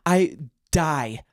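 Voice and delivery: ordinary talk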